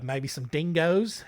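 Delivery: ordinary talk, nothing marked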